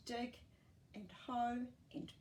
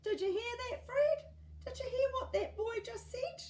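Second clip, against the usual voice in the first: put-on much higher, louder delighted old lady; Edna calling out; 7 dB louder than usual. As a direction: call to Fred in tickled delight. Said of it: "Edna would chuckle and call out".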